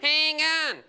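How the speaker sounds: high, loud honked "Hang on"; shortened bogan greeting. Shown as a demonstration. Comic repeating the shortened greeting, loud honked "Hang on". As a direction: blare Hang on in broad bogan. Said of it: "like a bogan ambulance".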